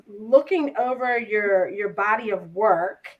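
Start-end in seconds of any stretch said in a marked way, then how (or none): none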